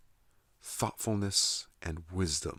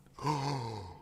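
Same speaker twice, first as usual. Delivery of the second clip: high, drawn-out mock gasp; imitated shocked gasp; 7 semitones higher than usual. Retelling how he reacted to his phone, mock shocked gasp. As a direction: gasp as if hit by huge news, hammed-up. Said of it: "then he suddenly went".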